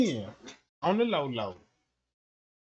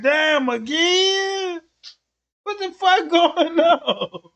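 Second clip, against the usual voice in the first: slow, higher, drawn-out disbelief; surprised; 5 semitones higher, much slower than usual. Retold or imitated. Waking up to Trump bombing again, slow high disbelief. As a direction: stretch it out like you can't believe it.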